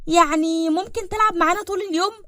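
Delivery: put-on high-pitched eager kid voice; hopeful child. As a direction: ask hopefully like a small child. Kind fragt nach Spielkameradin.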